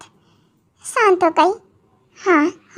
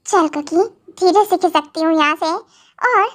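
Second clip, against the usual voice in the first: normal talking voice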